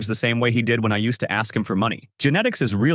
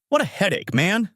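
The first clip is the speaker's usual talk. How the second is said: irritated; 9 semitones higher than usual. A narrator's reading. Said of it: "says irritably".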